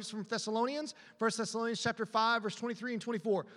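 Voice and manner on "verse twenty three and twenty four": ordinary talk, nothing marked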